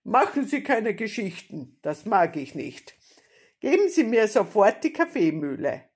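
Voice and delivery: loud, commanding bandit voice; threatening robber. A narrator's reading. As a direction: order her about with force.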